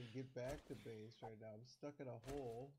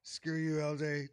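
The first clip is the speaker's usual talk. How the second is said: loud, much louder furious yell; real outburst at the prankster; angry shout; 3 semitones higher, 16 dB louder, slower than usual. Real, not acted. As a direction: shout it at your buddy. Friend scared him in game.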